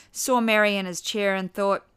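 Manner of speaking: usual speaking voice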